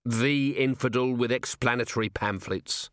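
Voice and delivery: measured, unexcited echo in an ordinary pitch; resigned repetition. Acted. Commander giving in to constable's correction, level and resigned. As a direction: concede the name in a level voice.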